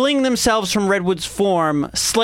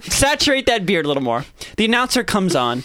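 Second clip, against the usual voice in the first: usual speaking voice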